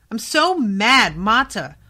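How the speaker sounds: loud slow frustrated shout at Mata; so mad. Real, not acted. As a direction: yell your fury at the character, drawn out.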